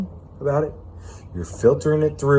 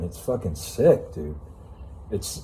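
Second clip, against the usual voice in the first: usual speaking voice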